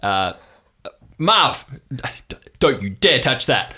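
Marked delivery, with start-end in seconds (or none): 1.2-3.7 raised, stammered warning to Marv; scolding yell. Acted. Dad warns uncle off touching something, raised and scolding. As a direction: snap a warning away from the phone. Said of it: "a scuffling and then a yelling".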